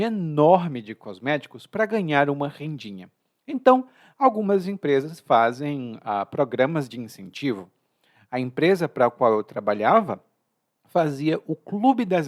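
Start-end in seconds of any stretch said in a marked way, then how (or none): none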